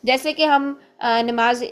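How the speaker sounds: ordinary talk, nothing marked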